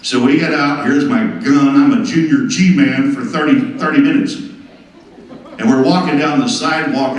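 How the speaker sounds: ordinary talk, nothing marked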